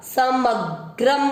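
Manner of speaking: समग्रम slowly with ग्र joined; careful teacher demo. Shown as a demonstration. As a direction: stretch समग्रम, binding ग and र. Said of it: "ग आधा और र पूरा".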